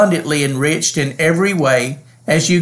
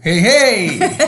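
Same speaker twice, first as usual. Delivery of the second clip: laughing; 6 semitones higher, 3 dB louder than usual. Real, not acted. Playful teasing between hosts.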